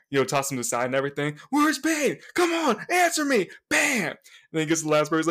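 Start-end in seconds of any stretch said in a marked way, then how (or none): 1.5-4.1 high-pitched, insistent interrogator; mock-shout, urgently demanding an answer; acted shouted demand. Agent grilling hostages for Bane, high mock shout.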